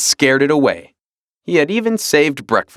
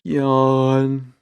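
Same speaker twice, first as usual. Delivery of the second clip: yawn; 2 semitones higher than usual. A narrator's reading. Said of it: "as long as".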